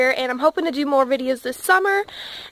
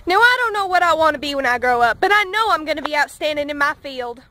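put-on southern accent. Hillbilly farm kid joking to camera, light Southern accent. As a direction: play a deadpan Southern country boy, lightly. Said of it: "thank heavens I have my southern accent".

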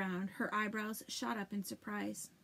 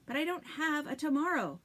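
near yell; 6 semitones higher, 6 dB louder than usual. Acted. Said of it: "Sophie wanted to yell at him".

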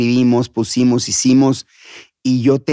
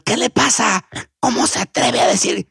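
higher, scandalized reprimand; acted indignation; 8 semitones higher than usual. Angry host reprimanding the young puppeteer, acted indignation. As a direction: imitate an offended boss scolding. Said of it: "cuando se enojaba te hablaba de usted".

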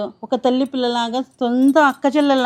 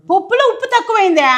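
indignant echo; 6 semitones higher than usual. Acted. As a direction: repeat her words as an offended challenge. Offended echo of the criticism.

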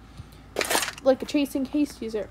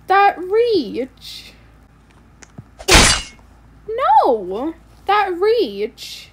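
outraged; 8 semitones higher, 9 dB louder, much slower than usual. Real, not acted. Outraged repeated complaint.

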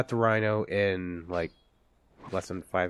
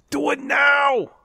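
high, slow urgent yell; excited shout; 9 dB louder, slower than usual. Acted. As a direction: bellow it like a cheering coach. Urging the bomb plant.

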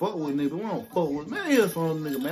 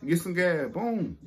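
normal talking voice

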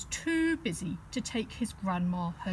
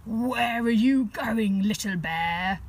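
sly wolf; 6 dB louder than usual. Acted. Wolf sweet-talking Teddy.

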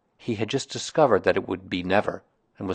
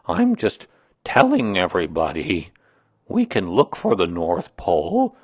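put-upon complainer with swooping emphasis; gloomy donkey, aggrieved; 3 dB louder than usual. Acted. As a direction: air the grievance heavily, dragging the words.

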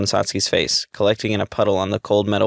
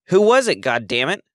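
much higher, loud scream fading on the curse; 8 semitones higher, 3 dB louder than usual. A narrator's reading. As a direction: shriek it, then spit the curse. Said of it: "she screamed again".

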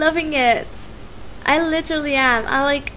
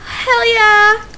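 excited cheer; 8 semitones higher, 3 dB louder than usual. Real, not acted. Cheering about going to uni.

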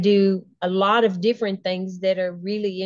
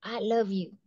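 soft self-affirmation; 6 dB quieter than usual. Shown as a demonstration. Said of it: "you're telling yourself".

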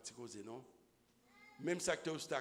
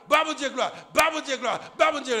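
glory cry, much higher and louder; praise shout; 11 semitones higher, 18 dB louder than usual. Acted. The mother praising God, loud shout.

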